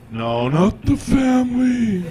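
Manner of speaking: slow, high, drawn-out ominous "the family"; hammed-up godfather menace; mock mafia gravitas. Comic aside to the crowd, slow mock-sinister mafia tone.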